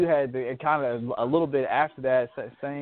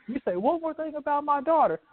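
mock angry dad; 13 semitones higher than usual. Quoting angry dad threatening the king.

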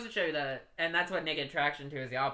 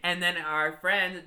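plain reading voice

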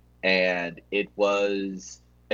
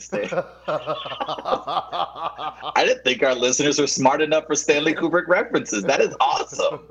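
laughing; 7 semitones higher, 4 dB louder than usual. Real, not acted. Delighted at the reference.

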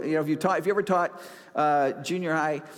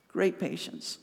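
Great patience repeated much softer, almost to himself; soft echo; 6 dB quieter, slower than usual. Acted. Preacher quietly echoes Great patience to let it sink in, softly.

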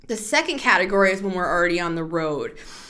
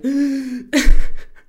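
sharp loud gasp for breath; gasping for air; 5 semitones higher, 9 dB louder than usual. Real, not acted. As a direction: suck in air like waking up choking. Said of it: "wake up in the middle of the night".

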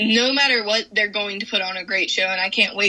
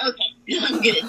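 laughing relief. Retold or imitated. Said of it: "And I was like".

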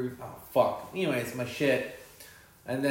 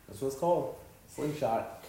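ordinary talk

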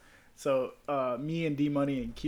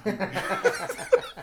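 laughing. Retold or imitated. Drunk in the car asking for Taco Bell, laughing. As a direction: giggle out the line.

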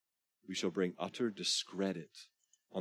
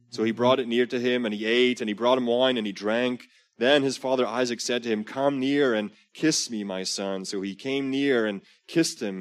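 louder, higher storytelling read of scripture; dramatic scripture reading; 4 semitones higher, 10 dB louder than usual. Acted. Preacher reading Genesis aloud to the congregation, loud and dramatic. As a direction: read the Bible scene out big for the room.

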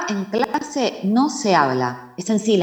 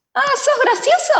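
mock threat. Sister threatening.

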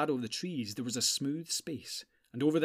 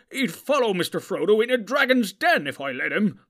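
higher and much louder protest; hot-headed hobbit defending his pony; 8 semitones higher, 12 dB louder than usual. A narrator's reading. Sam to Gandalf defending Bill, loud protest. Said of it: "protested Sam".

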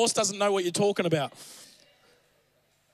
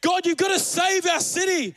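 an urgent, pleading prayer shouted to God; heart cry; 8 semitones higher than usual. Retold or imitated. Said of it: "a heart cry to God, like".

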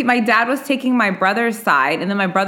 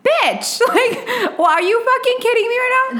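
high, pitched-up exasperated rant; mock furious outburst. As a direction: explode at the brother, voice shooting up. Retold furious outburst at brother.

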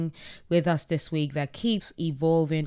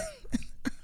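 high giggle; 7 dB quieter than usual. Real, not acted. Flustered over naming a symbol.

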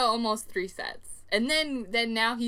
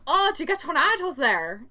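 higher, louder Scottish cabbie imitation; imitated Scottish accent; 7 semitones higher, 6 dB louder than usual. Cab driver asking visitors about Midwest weather, imitated Scottish accent. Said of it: "very hard to understand what he was saying".

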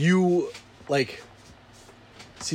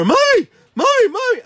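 put-on much higher, louder kid's cries of Mommy; child calling mommy; 5 dB louder than usual. Little kids running in calling for mommy, high and loud. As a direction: call for mommy like a small child.

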